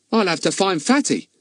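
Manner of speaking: high-pitched, puzzled lad; high boyish character voice. Acted. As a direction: pitch it right up, a baffled boy. Ern voiced in story.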